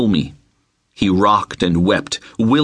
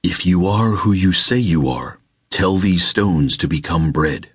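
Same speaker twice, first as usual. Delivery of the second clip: a calm, taunting spirit; tempting spirit; 4 semitones lower than usual. Acted.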